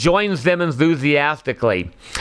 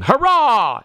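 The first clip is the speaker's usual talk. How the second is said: high, drawn-out cheer; storybook cry of triumph; joyful cheer; 9 semitones higher than usual. A narrator's reading. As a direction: let out a relieved cheer, pitched high. Father finds his son's ear.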